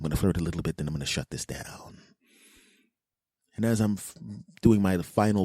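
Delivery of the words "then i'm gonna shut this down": whisper. Retold or imitated. Private plan, whispered.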